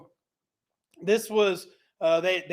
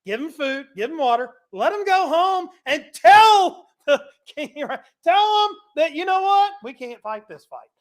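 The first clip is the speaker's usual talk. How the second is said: Elisha's orders; 6 semitones higher, 4 dB louder than usual. Retold or imitated. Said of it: "he's like".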